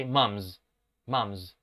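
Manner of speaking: "Mums" ending in a voiced z, mumz; final z in mums. Shown as a demonstration. Teacher drilling final voiced s in mums. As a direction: buzz the end of "Mums". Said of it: "se tiene que pronunciar con este sonido z".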